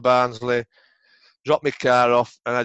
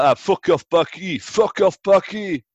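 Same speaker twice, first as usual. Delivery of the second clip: a light mocking French accent, a bit higher; 6 semitones higher, 3 dB louder, slower than usual. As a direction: imitate a French teammate brushing him off, lightly. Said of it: "in his French accent".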